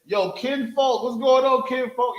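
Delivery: high, loud casual "yo kinfolk" greeting; hammed-up casual hail; mock street greeting. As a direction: toss it off like a slangy outsider. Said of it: "oppressor saying".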